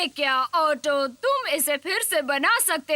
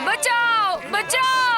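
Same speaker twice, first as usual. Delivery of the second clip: high, loud calls for help; frightened calling; cry for help; 8 semitones higher, 3 dB louder, much slower than usual. Acted. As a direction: scream for rescue.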